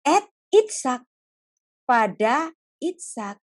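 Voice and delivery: slow, careful voice naming the son; God finally naming Isaac; slow, weighty naming. Acted. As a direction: let the name fall heavily. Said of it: "Kemudian barulah Tuhan bicara".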